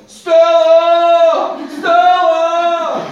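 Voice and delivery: long, high, loud howled name; imitating a yell. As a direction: bellow it like a lovesick man under a window. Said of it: "he keeps yelling".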